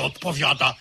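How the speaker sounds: ordinary delivery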